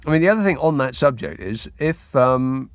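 ordinary talk, nothing marked

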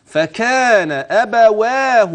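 أبواه slow with the alif held; slow chanted أبواه; vowel length. Shown as a demonstration. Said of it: "والألف".

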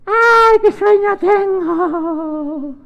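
high, long drawn-out sleepy wail; hammed-up yawning cry, very slow; acted sleepiness. Mateo at bedtime, hammed-up sleepiness. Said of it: "tenía mucho sueño".

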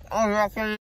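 muffled after dental surgery; mouth stuffed with gauze. Real, not acted. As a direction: lips held around gauze. Seen 2: Gauze in mouth visible from 3.5 s; the speaker for earlier words is unclear.

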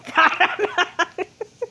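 a short high laugh burst; real laugh. Real, not acted. Owner laughing at the naughty pet, real laugh. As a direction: laugh out at the pet's antics.

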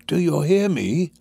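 raging cry. A narrator's reading. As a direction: demand to be heard, enraged. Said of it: "his eyes flashing with rage".

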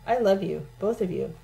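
ordinary delivery

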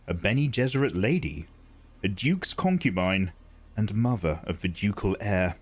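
ordinary delivery

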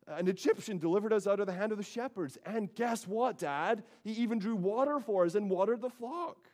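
high, fast, breathless telling; excited daughters. Acted. Daughters rushing home to tell their father, excited and high. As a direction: blurt it out like eager girls. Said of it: "They're pretty emphatic about that detail".

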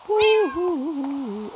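high gleeful woo-hoo; excited hooting. Real, not acted. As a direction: hoot with delight. Cheering at the puppy growing up.

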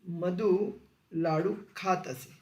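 spoken slowly word by word for students to copy; slow, clear classroom dictation. Shown as a demonstration. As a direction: dictate it slowly, leaving a gap after each word. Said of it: "रीती भूतकाळ".

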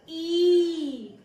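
ई held long and high; long-vowel demonstration. Shown as a demonstration. Teacher gives the matra's sound, long high ई. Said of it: "इसका उच्चारण है".

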